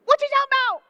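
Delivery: high, pressing confused questions; Thomas, baffled. Retold or imitated. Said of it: "But Thomas says".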